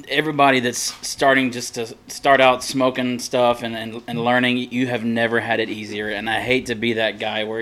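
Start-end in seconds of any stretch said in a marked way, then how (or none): none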